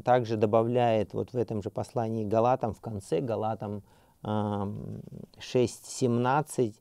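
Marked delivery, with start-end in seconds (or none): none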